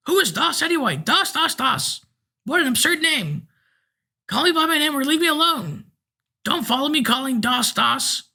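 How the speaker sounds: old man mocks the name Doss, piping and cross; indignant old man. A narrator's reading. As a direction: scoff at the name in a thin old voice. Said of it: "in a thin, piping voice".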